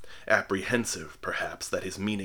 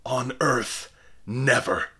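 louder, higher insistent cry; cried; 4 semitones higher, 5 dB louder, slower than usual. A narrator's reading. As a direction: refuse with all his might. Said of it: "cried the veiled clergyman".

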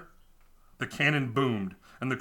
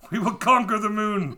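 mock conqueror; 8 semitones higher, 6 dB louder than usual. Mock sailors threatening moon.

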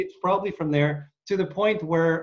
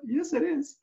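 much higher, mock-exasperated concession; high-pitched admission; 12 semitones higher than usual. Dad retelling checking his Russian, high-pitched admission. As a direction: jump up high to concede the point. Retold admission after denying it.

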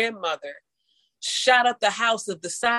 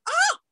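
very high-pitched astonished "oh"; retold gasp of a voice shooting up; overwhelmed cry. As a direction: squeak it out in mock amazement. Retells being overwhelmed by response.

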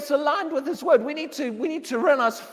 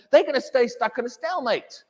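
ordinary talk, nothing marked

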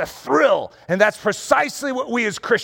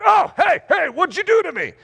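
startled protest; 9 semitones higher than usual. Acted. Flipped trial calling up from its back, startled protest. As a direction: yelp it as if just thrown.

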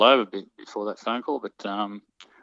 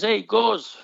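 put-on yelled out high and loud in a Scottish accent; imitated Scottish accent; 12 semitones higher, 5 dB louder than usual. Teammate hailing the storyteller across the road, Scottish accent. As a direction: call across the street like a Scot, pitched up. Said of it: "Scottish accent".

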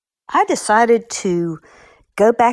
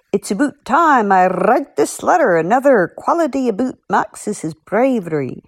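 Max's Scottish voice; 4 semitones higher than usual. Retold or imitated. Author reading a fan's Max quote, light Scottish. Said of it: "As Max would say".

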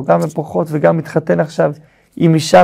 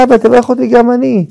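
higher-pitched imitation of a wailing son; mock crying plea; 6 semitones higher than usual. Esau begging his father for a blessing, mock tearful. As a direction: plead with father in a lightly acted cry. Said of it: "הוא מתחיל לבכות, לצרוח".

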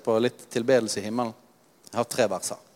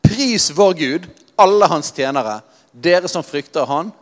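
slow, loud, high command to praise; heavenly command, slow and forceful; 4 semitones higher, 7 dB louder, slower than usual. Acted. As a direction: deliver it as an order from the throne. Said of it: "Dette er en kommando".

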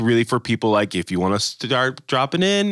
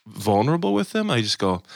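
normal talking voice

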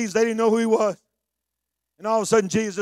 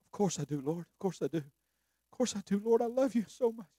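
earnest Peter; 12 dB quieter than usual. Acted. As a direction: protest love quietly. Peter answers Jesus.

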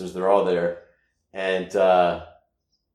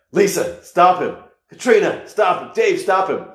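mom calling out; 8 semitones higher, 4 dB louder than usual. Retold or imitated. Mom calling siblings for help.

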